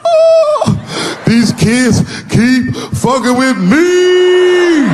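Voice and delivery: very high, slow bawling whine. Retold or imitated. Son crying to dad, slow high wail. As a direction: sob it out, wailing slowly. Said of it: "started crying like he was [name]".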